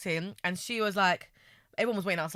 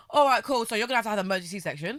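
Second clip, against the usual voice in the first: rushed doctor; 2 semitones higher, faster than usual. Retold or imitated. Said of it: "So he goes to me".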